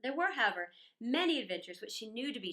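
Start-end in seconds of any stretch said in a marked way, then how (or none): none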